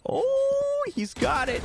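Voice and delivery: high thrilled cry; excited. Real, not acted. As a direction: burst out in excitement. Clutch defuse succeeds.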